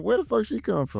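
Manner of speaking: high, baffled retold reaction; incredulous. Viewer retelling shock at a surprise character, incredulous. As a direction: question it in high-pitched disbelief.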